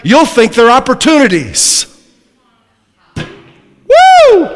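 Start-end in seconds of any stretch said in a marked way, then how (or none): 3.1-4.5 loud, high "woo"; piercing preacher's whoop. Real, not acted. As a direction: let out a sudden high-pitched whoop. Preacher whoops mid-sermon.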